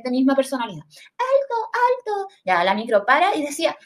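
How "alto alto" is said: high, prim alto, alto; mock posh lady calling stop. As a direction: play the snooty twin of yourself. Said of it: "una versión súper cuica".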